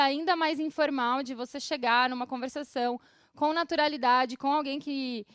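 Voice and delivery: usual speaking voice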